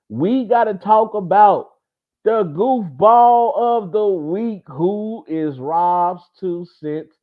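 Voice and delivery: high, drawn-out show-host announcement; segment announcer hype. Real, not acted. Host unveils his goofball of the week, hyped announcer voice. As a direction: stretch the segment title out big and high.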